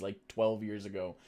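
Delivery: ordinary talk, nothing marked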